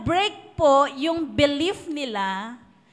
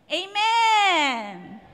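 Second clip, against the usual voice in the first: 1 rousing amen; 4 semitones higher, 5 dB louder than usual. Real, not acted. Preacher calls for congregation response.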